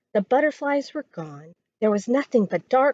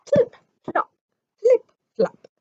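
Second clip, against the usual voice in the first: squeaky high chant of flip flop; high frog sound; 12 semitones higher, 4 dB louder than usual. Acted. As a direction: chirp it out like a hopping frog. Frog hopping sound effect.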